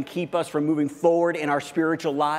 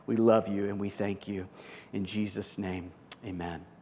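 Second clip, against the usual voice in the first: earnest prayer; 4 semitones lower than usual. Acted. Pastor praying over the congregation, in an earnest, hushed prayer voice.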